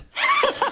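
squealing high laugh; high burst of laughter. Real, not acted. Prank revealed.

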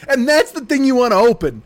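usual speaking voice

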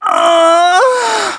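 high breathy goose honk; sexy goose voice. Pretend. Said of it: "please do a sexy goose voice".

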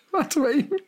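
spoken through real laughter, high; laughing. Real, not acted. Still cracking up at the co-host's question, laughing through the words. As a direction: still laughing, can barely get it out.

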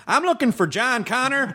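the Terminator with a high Southern twang. Retold or imitated. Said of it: "if you were like".

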